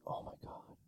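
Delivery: hushed, unvoiced mock awe; imitated admiring whisper. Bingo caller imitating an admirer in the back, whispered. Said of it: "She'd be like".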